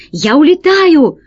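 high panicked shout; rat shouting. A narrator's reading. Rat fleeing the ship, high panicked shout. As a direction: call out that you're fleeing. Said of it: "кричал крыс".

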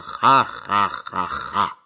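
slow rolling evil laughter; booming villain laugh. Acted. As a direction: roll out a big evil laugh. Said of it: "громко, раскатисто расхохотался". (раскатистый злодейский хохот)

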